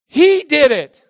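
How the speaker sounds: put-on childish pointing whine; blaming whine. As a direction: pass the blame. Eve blaming devil.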